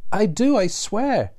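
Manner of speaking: high, slow, desperate plea; pleading girl. A narrator's reading. Frightened girl swears to the gunmen, high and slow. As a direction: beg them to believe you, slowly.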